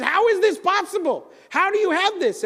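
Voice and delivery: high, loud outraged demand; confronting the neighbor he accused of theft; furious. Acted. Leather worker confronts neighbor over ring, furious high demand. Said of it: "And he is furious".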